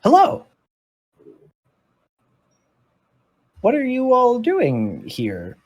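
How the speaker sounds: very high, surprised character voice; high-voiced odd creature meeting intruders; curator creature greeting. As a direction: pitch it way up, drawing out the question. Game master voices the curator creature.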